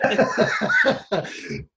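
high laugh at his own joke. Real, not acted. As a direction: laugh off the teasing.

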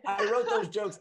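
loud, high, laughing boast. Real, not acted. Author to hosts, laughing proudly about his jokes. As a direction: crow about it through laughter.

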